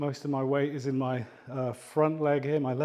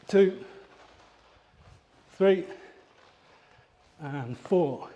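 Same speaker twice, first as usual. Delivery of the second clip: effortful count; 3 semitones higher, slower than usual. Real, not acted. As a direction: call the count out mid-kick. Counting kicks during exercise.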